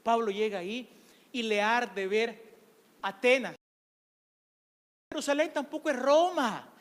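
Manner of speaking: ordinary talk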